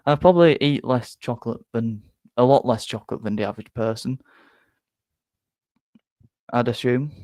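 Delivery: usual speaking voice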